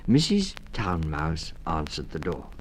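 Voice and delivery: usual speaking voice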